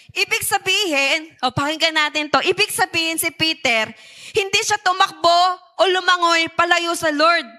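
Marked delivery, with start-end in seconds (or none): none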